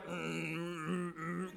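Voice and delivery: low, soft grudging mumble-groan; reluctant groan. Retold or imitated. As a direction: imitate someone straining not to answer. Woman's grudging answer, imitated.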